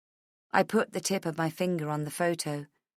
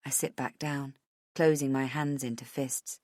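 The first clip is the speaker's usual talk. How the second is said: plain reading voice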